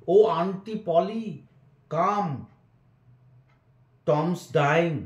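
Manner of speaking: alarmed call. A narrator's reading. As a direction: call downstairs in a panic. Sid calling downstairs in alarm.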